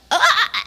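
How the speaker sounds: high laughing "Ah!" of frustration; frustrated laughing yelp. Retold or imitated. Flailing at her partner mid-debate, laughing yelp. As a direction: yelp in mock exasperation. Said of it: "in my mid flail".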